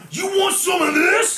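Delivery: high, drawn-out battle yell; loud, slow, strained taunting shout; Private Hudson. Acted. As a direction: holler a hammed-up challenge at the enemy. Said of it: "Private Hudson".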